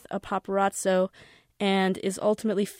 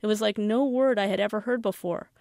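ordinary delivery, nothing marked

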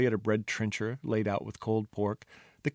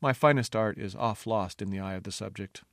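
plain reading voice

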